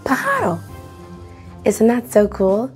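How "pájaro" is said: ordinary delivery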